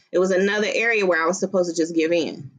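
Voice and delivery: normal talking voice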